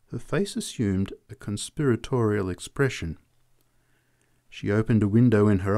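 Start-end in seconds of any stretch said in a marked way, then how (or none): none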